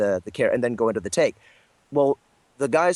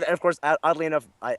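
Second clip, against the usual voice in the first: ordinary talk, nothing marked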